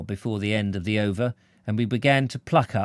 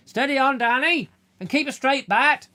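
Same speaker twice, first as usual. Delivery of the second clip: roaring. A narrator's reading. Old man roaring advice at Danny. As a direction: bellow it out to the lad. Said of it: "He roared out the last two words".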